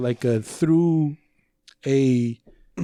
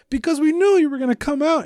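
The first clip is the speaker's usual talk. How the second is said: mock retort; 13 semitones higher, 3 dB louder than usual. Kids answering neighbor.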